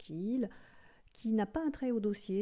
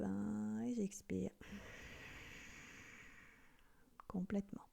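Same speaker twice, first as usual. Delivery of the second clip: guided breathing; 6 dB quieter than usual. Acted.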